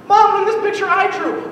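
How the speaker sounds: little sister. Retold or imitated. Little sister showing mom.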